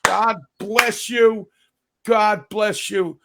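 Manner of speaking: slow, drawn-out preacher parody; mock televangelist. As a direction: play a pleading TV preacher, words stretched. Mock preacher begging for donations.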